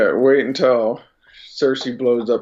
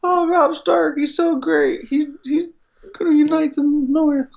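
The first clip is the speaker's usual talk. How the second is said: much higher gushing fangirl voice; mock gushing fan; 14 semitones higher than usual. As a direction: gush naively over Robb Stark. S1 mocks wife's excitement.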